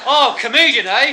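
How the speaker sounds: high, loud mocking retort; bellowed sarcasm; screw's loud jibe. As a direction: shout it at the smart alec. Acted screw sneering.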